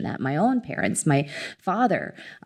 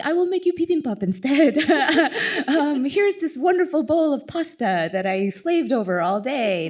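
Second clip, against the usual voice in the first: playful grandma; 8 semitones higher than usual. Retold or imitated. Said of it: "my grandma's language is just like".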